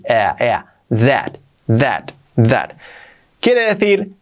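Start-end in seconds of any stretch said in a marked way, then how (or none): 0.0-2.7 that with a vowel between e and a; open a in that. Shown as a demonstration. Teacher shaping the vowel of that. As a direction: stretch the ea vowel in that. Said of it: "y la A un poco así".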